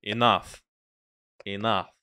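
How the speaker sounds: put-on light Russian accent, firm refusal. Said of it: "I've got a decent Russian accent".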